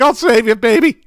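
very high, laughing cry; mock-heroic plea. Host to the actress on screen, playful high-pitched rescue. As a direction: call it out high, breaking into a laugh.

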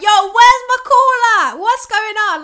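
loud, high, slow indignant demand; mock outrage. To the silent phone, loud slow indignant demand. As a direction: belt out where's my call at like you're fed up. Frustrated she demands where his call is.

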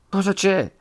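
sudden worried high question; startled priest; Don Abbondio alarmed. A narrator's reading. (il curato allarmato, voce alta)

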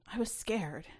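usual speaking voice

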